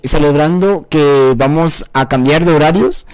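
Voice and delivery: loud, emphatic podcast plug; loud talking. Real, not acted. Host plugging the podcast to listeners, too loud. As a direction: talk too loudly, right into the mic. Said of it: "Ah, no grites".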